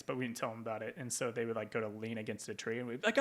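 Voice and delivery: ordinary delivery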